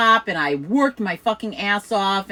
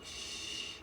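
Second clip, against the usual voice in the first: imitated boss's hush; a soft, drawn-out shush; 22 dB quieter than usual. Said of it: "he would be like".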